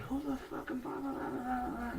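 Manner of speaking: high mock voice of a ranting father; mocking dad lecture. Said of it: "starts lecturing me".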